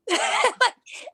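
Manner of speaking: real laugh bursting out high; high burst of laughter. Real, not acted. Laughing at her own comeback to [name], high laugh. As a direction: let a high laugh break out.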